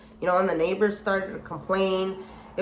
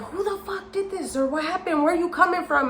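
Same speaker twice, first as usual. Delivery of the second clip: imitated yelling; 10 semitones higher, faster than usual. Dad yelling about his daughter's wrecked car, imitated high-pitched. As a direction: mimic an outraged dad's rapid questions, pitched way up. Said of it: "he starts yelling. He's like".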